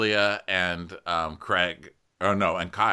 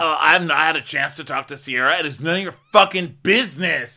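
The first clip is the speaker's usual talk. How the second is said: loud, high mock rage; worked-up, shouted retort; raging; 7 semitones higher, 8 dB louder than usual. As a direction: imitate a man flying off the handle. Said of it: "already fucking raging".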